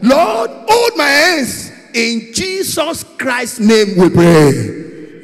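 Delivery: loud preacher's prayer, building in force; full-voiced pleading prayer; fervent prayer. Real, not acted. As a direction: cry out to God, stretching the last phrases. Leads congregation in fervent prayer.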